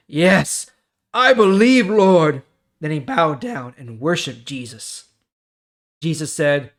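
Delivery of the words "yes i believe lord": high, loud declaration of faith; fervent confession. Acted. Healed man confesses faith to Jesus, high and loud. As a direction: cry it out with full conviction. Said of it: "The man answered".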